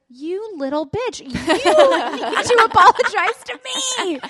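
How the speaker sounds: laughing mock toddler. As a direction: mimic the child back while cracking up. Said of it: "And I was like".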